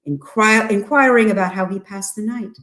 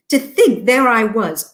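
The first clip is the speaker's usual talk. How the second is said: plain reading voice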